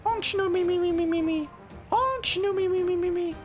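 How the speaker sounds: high squeaky honk-shoo snore; cartoon snoring. Imitating sleeping Pokemon snore.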